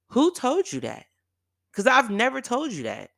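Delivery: high, loud retold challenge; indignant retort. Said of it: "And I got mad".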